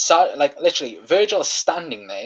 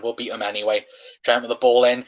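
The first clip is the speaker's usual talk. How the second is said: ordinary talk, nothing marked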